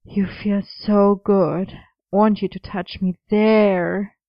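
slow, hammed-up come-on; awful seductive talk. A narrator's reading. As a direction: draw it out like a bad mock seduction. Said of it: "you use awful dirty talk".

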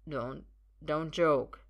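slow, halting stammer; thick-tongued, faltering protest; drugged pleading. Acted. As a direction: plead woozily, dragging the words. Drugged Arthur struggles to speak.

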